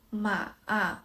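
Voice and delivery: "ma, a" dipping down, then turning up; third tone. Shown as a demonstration. Teacher shows the turned tone, "ma" falling then rising. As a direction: drop "ma" and curve it back up. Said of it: "也就是先降后升".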